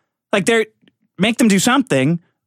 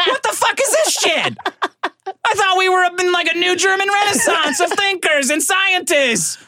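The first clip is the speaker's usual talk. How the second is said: outraged partier; 9 semitones higher than usual. Retold or imitated. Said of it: "He's like".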